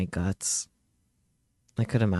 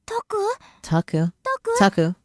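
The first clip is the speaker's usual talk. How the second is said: put-on a light girl's voice, higher and louder; worried girl calling; 10 semitones higher, 6 dB louder than usual. Childhood friend calling out to Takuru, worried girl's voice. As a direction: call him back to himself.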